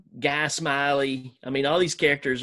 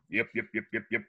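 Yip Yip alien voice; 4 semitones lower, 8 dB quieter, much faster than usual. Retold or imitated. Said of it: "the yip yips".

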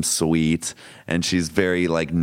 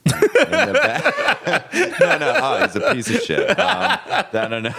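laughing; 3 dB louder than usual. Real, not acted. Cracking up at own joke.

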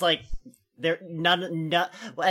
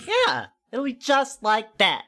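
Gilbert Gottfried impression; 5 semitones higher, slower than usual. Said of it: "just like I'm doing the voice".

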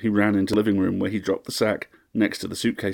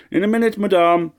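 loud, higher reply; called back; 10 semitones higher, 7 dB louder than usual. A narrator's reading. Butler calling back to his mistress, loud. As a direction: call it across the house.

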